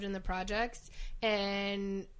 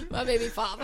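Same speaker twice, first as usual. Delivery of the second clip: laughing; 3 semitones higher, 3 dB quieter than usual. Real, not acted. Repeating the punchline, laughing.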